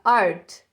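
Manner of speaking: "Art" ending on a bare T; final T sound. Shown as a demonstration. Teacher modelling the English word for students, final T sound. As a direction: say "Art" low and firm, stopping on the T. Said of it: "termina com o som de T".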